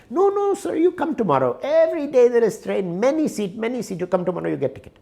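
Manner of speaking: playing an Indian booth clerk who keeps refusing, in an Indian accent; the clerk brushing off the tourist in a high Indian accent. Indian ticket clerk insists.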